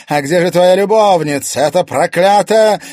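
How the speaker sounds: high, very loud jealous outburst; loud, venomous demand; furious accusation. A narrator's reading. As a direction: hurl it at a cheating husband. Possessed wife berates her husband.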